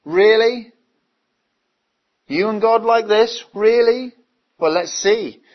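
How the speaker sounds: loud, high scornful "Really?"; sarcastic mockery. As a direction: scoff at the claim, jeering. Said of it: "See the mockery".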